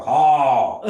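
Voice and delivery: ha said loud and strong like a grandmother; student finally saying ha with force; forceful ha. Retold or imitated. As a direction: hit ha with force. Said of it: "She go".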